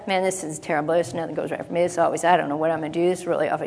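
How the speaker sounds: low, soft defeated grumbling; mopey complaining. Retold or imitated. Preacher mimicking a believer's gloomy self-talk. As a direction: mutter it like nothing ever goes right. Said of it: "instead of".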